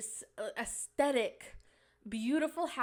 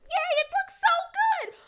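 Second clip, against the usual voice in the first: squealing, far higher and louder; mocking cheer; 9 dB louder, much slower than usual. Mocking house flippers with a squealing fake cheer. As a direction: squeal it like a smug fake fan. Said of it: "and then be like".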